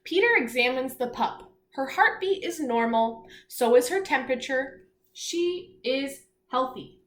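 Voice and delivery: lightly storybook read-aloud; reading aloud to children. Acted. Teacher reading a seal story to children, storybook voice. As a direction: read the page clearly to the class. Reads picture book aloud.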